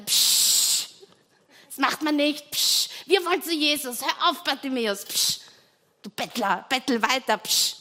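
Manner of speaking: loud, high scolding shushes; annoyed bystanders hissing at the blind man; hushing crowd. Acted. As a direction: shut the beggar up.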